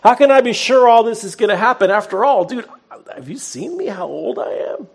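hammed-up skeptic pushing back at the angel; high mock voice of a doubting old priest; doubting Zechariah. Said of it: "He says these words to him".

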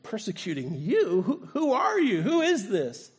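confused, rising high on who; shocked and bewildered, asking who speaks; stunned disbelief. Retold or imitated. Saul on the ground asking the voice, stunned. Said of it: "He's like".

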